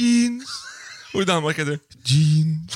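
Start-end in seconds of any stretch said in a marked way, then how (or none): 0.0-0.5 "jeans" sung upward in Norwegian. Shown as a demonstration. Comparing countries' words for jeans, imitated Norwegian lilt. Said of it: "på Norge heter det". 2.1-2.7 mock-gloomy Danish "jeans"; gloomier Danish. Shown as a demonstration. Comparing countries' words for jeans, mock-gloomy Danish. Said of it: "Det är lite dystrare bara".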